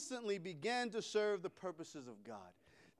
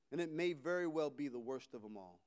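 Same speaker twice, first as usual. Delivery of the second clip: ordinary talk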